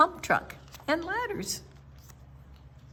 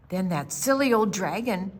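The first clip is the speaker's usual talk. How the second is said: usual speaking voice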